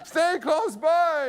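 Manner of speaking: high, drawn-out comic call; boy calling out. Retold or imitated. Son wheeled away to Dad, high drawn-out call. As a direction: imitate a child calling back, stretching each word.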